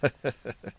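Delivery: short soft acted chuckle; light, quiet storytelling laugh; old granny's chuckle. A narrator's reading. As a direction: chuckle softly as the grateful old woman. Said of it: "刘姥姥道".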